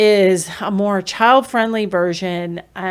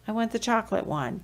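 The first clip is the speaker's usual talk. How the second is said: soft modelling voice; 3 semitones higher, 7 dB quieter, faster than usual. Shown as a demonstration.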